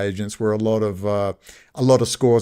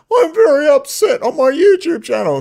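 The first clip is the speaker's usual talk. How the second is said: high, louder whiny parody voice; mock whiner; 7 dB louder than usual. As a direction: ham up a pathetic YouTube complainer, pitched high. Mocking a whiny online complainer.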